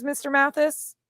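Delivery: normal talking voice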